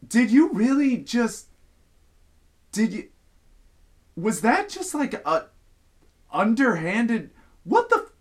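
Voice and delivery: high, loud, broken-off starts in disbelief; re-enacted sputtering disbelief; shocked stammering. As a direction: act out stammering in shock, building to an outburst. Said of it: "as I'm stammering there".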